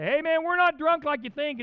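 loud, high protest to a crowd; acted indignant shout; calling out. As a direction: stand up and holler it in defense. Peter stands up to address crowd.

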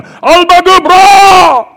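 very high, drawn-out full shout; battle cry. Acted. Commander sends troops charging, with a huge war cry.

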